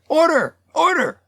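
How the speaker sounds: very high call to order; chairman calling order. A narrator's reading. Chair interrupts the tanner, high call to order. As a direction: cut in as the chair, pitched high. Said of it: "The chair".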